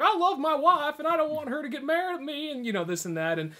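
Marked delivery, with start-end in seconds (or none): 0.0-2.5 high nervous meek voice; henpecked cousin. Retold or imitated. Cousin explaining to Trevor, high timid voice. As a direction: squeak it out like a timid husband. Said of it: "he's some guy like".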